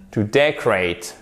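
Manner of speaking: "decorate" with DEC stressed, falling after; stress on first syllable. Shown as a demonstration. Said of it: "accent tonique sur la première syllabe". (« decorate » accentué sur la première syllabe, DEC)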